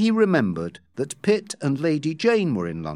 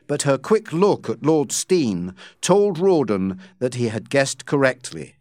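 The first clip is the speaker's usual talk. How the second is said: ordinary delivery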